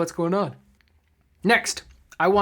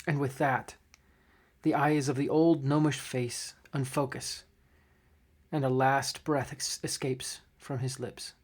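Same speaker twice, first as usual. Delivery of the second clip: somber game master narration; 3 semitones lower, 6 dB quieter than usual. A narrator's reading. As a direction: narrate the death scene gently. Game master narrates dying gnome.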